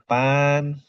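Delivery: pan held long on a high level tone; tone demonstration. Shown as a demonstration. Teacher showing how characters read, pan drawn out high and level. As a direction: sustain pan, high and even. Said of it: "Ini juga bacanya pan".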